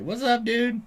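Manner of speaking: squeaky-high cat voice saying hi; cat greeting. Retold or imitated. Owner voicing his cat sitting on the floor, high mock voice.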